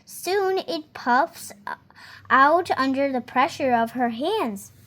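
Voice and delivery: plain reading voice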